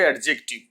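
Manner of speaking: অ্যাডজেক্টিভ as ad-jek-tiv, d sounded; common mispronunciation. Shown as a demonstration. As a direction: put a hard d before j in অ্যাডজেক্টিভ. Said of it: "উচ্চারণ ভুল করে আসি".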